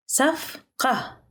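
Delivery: careful teaching pace on "صفقة"; syllable by syllable. Shown as a demonstration. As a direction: break "صفقة" into separate syllables for learners. Teacher repeats word broken into syllables.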